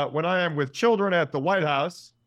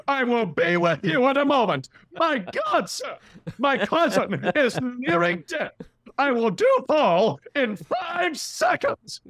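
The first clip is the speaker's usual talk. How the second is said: much higher, louder, pleading; frantic Giamatti; 11 semitones higher, 4 dB louder, slower than usual. Retold or imitated. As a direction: beg the stranger to wait, urgent. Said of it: "And I'm like".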